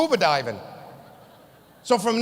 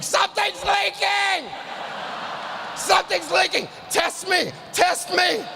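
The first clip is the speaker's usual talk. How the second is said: screaming; 8 semitones higher, slower than usual. Pretend. Comedian at the power plant door, screaming in mock panic. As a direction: yell it like the plant is melting down. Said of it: "start screaming".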